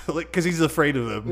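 normal talking voice

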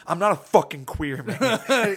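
loud, drawn-out mock protest; imitating upset man. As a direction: act out his angry denial, slowly and loudly. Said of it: "He really did get super upset".

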